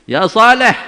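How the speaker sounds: loud high call "Ya Saleh"; calling by name. Retold or imitated. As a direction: call out "Ya Saleh" boldly without "Nabi". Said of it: "Nggak pakai Nabi lagi". (memanggil lantang "Ya Saleh")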